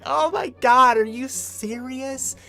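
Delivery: high, drawn-out exasperated whine, slow; mock her groaning disbelief; annoyed girlfriend. Groggy girlfriend annoyed at waking.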